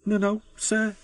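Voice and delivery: high, hesitant, nervous answer. A narrator's reading. Oliver answering the fat controller, nervous. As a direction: answer nervously, pitched right up. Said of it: "said Oliver nervously".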